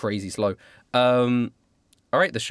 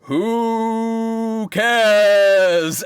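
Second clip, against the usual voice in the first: loud dismissive creature; 10 semitones higher, 8 dB louder than usual. Acted. Tree answers player dismissively.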